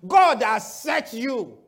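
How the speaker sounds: loud and slow, stretched out; emphatic preaching. Real, not acted. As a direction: lean hard into each word.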